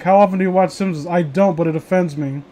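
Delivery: normal talking voice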